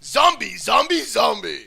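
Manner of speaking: loud booming announcer hype; monster truck ad. Pretend. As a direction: bellow it like an arena promo. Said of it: "monster truck show and they go".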